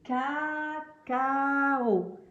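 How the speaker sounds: cacao stretched very slowly as caa-caa-oo; syllables blended slowly. Shown as a demonstration. Teacher reveals the decoded word, cacao hugely stretched by syllable. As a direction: draw out each vowel of cacao on a held tone. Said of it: "On appelle ça une syllabe".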